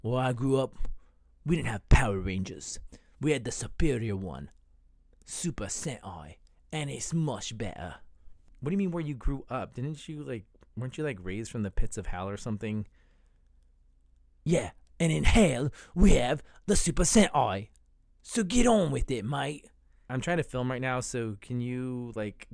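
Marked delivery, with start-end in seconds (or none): 0.0-7.9 lightly British, a bit snooty; British roommate voice. Acted. Roommate bragging about Super Sentai in a British accent. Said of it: "why he talks like that". 14.4-19.7 put-on British accent, pushy and impatient; British demon roommate. Roommate from hell telling the host in a British accent to hurry up. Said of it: "why he talks like that".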